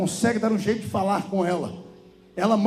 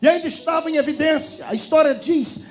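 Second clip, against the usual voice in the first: high, ringing sermon delivery; full-force preaching; 7 semitones higher than usual. A narrator's reading. Preacher telling of the harvest nearly ready, full-force preaching. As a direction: preach with mounting urgency, pitched well up.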